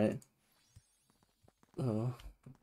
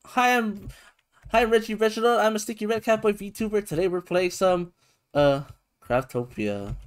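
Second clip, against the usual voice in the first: higher, louder cheery host intro; streamer intro voice; 8 semitones higher, 4 dB louder than usual. Acted. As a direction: introduce yourself like a bubbly host.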